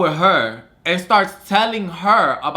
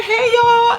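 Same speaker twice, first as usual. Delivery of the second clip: high, bright mock-cheerful hello; cheery greeting; 4 dB louder than usual. Walking upstairs to roommates, a high cheerful hello. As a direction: breeze in sing-song and oblivious.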